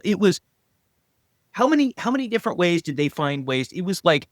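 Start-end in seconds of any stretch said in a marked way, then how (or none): none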